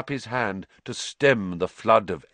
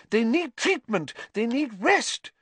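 spluttering matron. Acted. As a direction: splutter in outrage. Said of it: "spluttered Madam Pumphrey".

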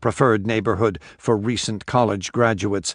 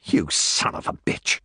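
shaking with anger; 4 semitones lower than usual. Acted. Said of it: "quivering with rage".

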